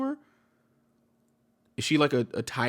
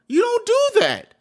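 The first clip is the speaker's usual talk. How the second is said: high shouted scold; 14 semitones higher, 6 dB louder than usual. Real, not acted. Streamer scolding the husband, high shout. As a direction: yell it high and loud, scolding. Scolding someone who did wrong.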